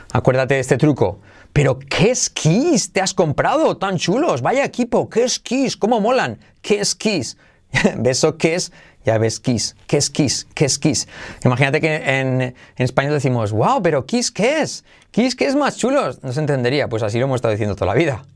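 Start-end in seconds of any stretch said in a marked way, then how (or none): 1.5-7.4 high-pitched, gushing admiration; admiring exclamation. Acted. Teacher acting out a memory trick, excited high-pitched admiration. 13.5-16.2 high, exclaiming wow voice; hammed-up high-pitched astonishment; mock amazement. Teacher showing a nonsensical mix-up, high mock amazement. Mocking the swapped vowels as exclamation.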